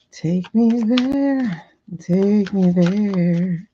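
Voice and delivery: very slow, drawn-out words; slow invocation. Real, not acted. Reader invoking the cards, slow and drawn out. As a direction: coax the cards to show you. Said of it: "let me get back in this energy".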